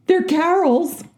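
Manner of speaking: high, timid plea from a poor robber; hammed-up nervous punchline; nervous wreck. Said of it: "Third guy is a nervous wreck".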